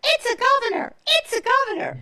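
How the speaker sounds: high, loud excited shriek; retold scream of triumph, high and loud. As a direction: burst in shrieking the good news. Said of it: "she comes running into the convention screaming".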